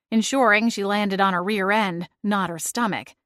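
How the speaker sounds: usual speaking voice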